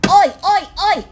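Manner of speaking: loud, high Australian "Oi, oi, oi!" call; Aussie "oi" shout. Pretend. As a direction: give them the full Aussie yell. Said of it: "Australians would know what that means".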